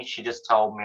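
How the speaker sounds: ordinary delivery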